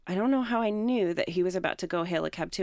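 normal talking voice

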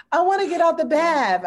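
put-on high, loud childish whine; whiny toddler. Mother imitating her daughter's loud childish whine. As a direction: whine like a little girl at bath time. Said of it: "she'll be like".

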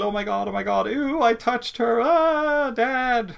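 put-on high, drawn-out squeals of horrified kids; children's scream. Kids finding they touched only her stretched arms, horrified screaming. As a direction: imitate grossed-out kids, building to a loud cry for dad. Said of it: "skriker de till".

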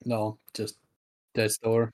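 ordinary talk, nothing marked

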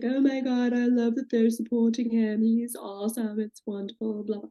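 a hint of Lisa cooing over the party; mocking Lisa's sweet gushing at the Viper Room. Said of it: "Lisa's like".

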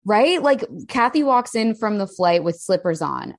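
normal talking voice

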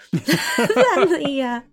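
breaks into a real laugh mid-phrase. Real, not acted. Laughing at own funny admission.

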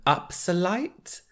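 slow, deliberate name; slow repeat. Shown as a demonstration. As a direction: spell the name out slowly. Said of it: "What's it called?".

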